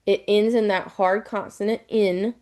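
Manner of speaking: ordinary talk, nothing marked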